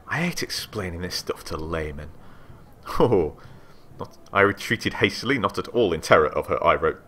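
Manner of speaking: ordinary talk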